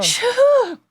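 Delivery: high, long whoosh of breath; exasperated phew. Real, not acted. Guest recalls the long job hunt, exasperated phew. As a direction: blow out a big phew. Reacts to how long it took.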